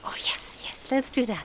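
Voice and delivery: soft whispered yes; whispering. Real, not acted. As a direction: breathe it, barely voiced. Quiet eager agreement aside.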